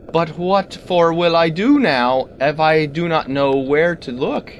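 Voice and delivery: ordinary delivery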